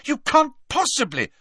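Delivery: very high, shocked protest; shocked Hermione. A narrator's reading. Hermione protesting Harry's threat to the door, shocked. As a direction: object in high-pitched disbelief. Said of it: "sounding shocked".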